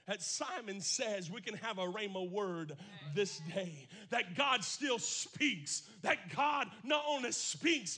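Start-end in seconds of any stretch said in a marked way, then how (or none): 4.1-8.0 slow then loud preaching cry; preaching shout. Acted. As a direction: hammer it out, louder. Preaching peak.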